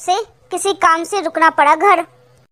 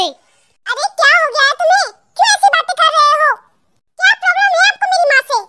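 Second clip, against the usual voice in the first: higher-pitched, exasperated challenge; upset protest; 7 semitones higher than usual. Acted. Wife defending her mother to husband, upset protest. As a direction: confront him, pitched up and indignant.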